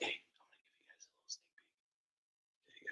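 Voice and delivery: hushed secret whisper. Shown as a demonstration. Repeated then whispered.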